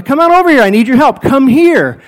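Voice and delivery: loud, high call across the room; calling over. Retold or imitated. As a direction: wave and shout them over. Calling a worker over loudly.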